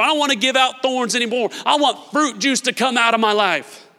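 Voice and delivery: loud, high, driving sermon voice; preaching at full force. Acted. Pastor preaching to his church, full force. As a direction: preach it hard to the room.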